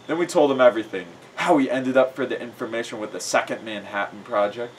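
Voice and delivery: ordinary delivery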